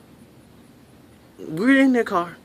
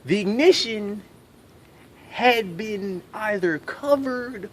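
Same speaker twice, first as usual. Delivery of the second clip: slow dramatic reveal; 3 semitones higher, much slower than usual. Acted. Comic revealing the missing ignition, slowly. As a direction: drag out each word for effect.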